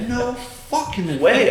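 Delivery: high, slow no fucking way; high incredulous refusal. Retold or imitated. Hearing screams from basement, high slow refusal. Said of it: "And I'm like".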